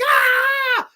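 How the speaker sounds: high, loud piercing scream; mock scream. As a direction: scream as if bitten. Woman screams as she is bitten.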